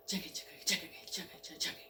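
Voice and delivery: soft unvoiced chugga chugga like a steam engine; whispered train chug. Narrator makes the train chug, whispered. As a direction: breathe out the train's chug under your breath.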